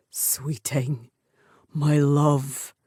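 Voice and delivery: lightly voiced warrior murmuring endearments; tender mutter. A narrator's reading. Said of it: "he muttered against her swollen mouth".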